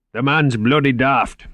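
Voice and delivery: slow mock Scottish burr; imitating a Scottish accent with relish; Cuthbert's Scottish accent. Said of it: "imitated Cuthbert's Scottish accent".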